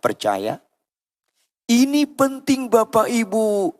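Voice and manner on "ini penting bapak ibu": high, loud sermon cry; impassioned, ringing preacher; preaching at full force. Real, not acted. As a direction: exhort the congregation from the top of your voice.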